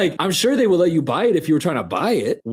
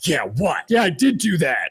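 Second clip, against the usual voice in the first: mock defiant old man. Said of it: "his vibe of just".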